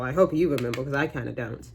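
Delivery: ordinary talk, nothing marked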